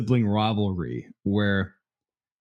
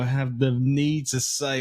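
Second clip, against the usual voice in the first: ordinary delivery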